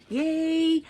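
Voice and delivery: high, loud little cheer; excited gift-opening yay. Real, not acted. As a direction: squeal with delight.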